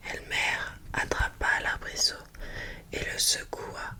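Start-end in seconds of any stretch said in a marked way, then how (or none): none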